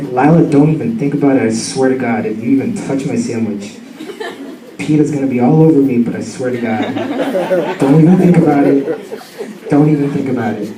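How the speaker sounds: low, restrained mock threat; stern warning. Owner warning the dog off his sandwich, mock stern warning.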